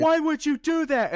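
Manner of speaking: panicked shout. Retold or imitated. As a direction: freak out at your friend. Mocked panicked friend.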